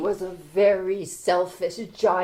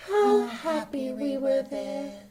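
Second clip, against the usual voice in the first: sadly; 5 semitones higher, 4 dB quieter than usual. Acted. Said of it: "they said to each other so sadly".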